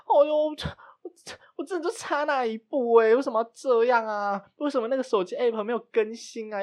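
high, whiny string of complaints, close to crying; a retold near-sob, pitched high and pleading; near-tears whining. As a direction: pour out every grievance on the edge of tears. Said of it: "就差点哭出来".

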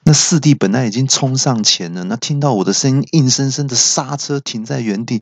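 usual speaking voice

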